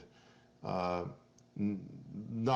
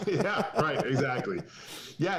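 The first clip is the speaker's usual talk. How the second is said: laughing; 7 semitones higher than usual. Real, not acted. Laughing at friend's story.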